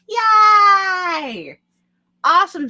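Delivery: loud, high, happy cheer; excited cheer. Real, not acted. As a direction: cheer with delight. Cheering a chat member's plans.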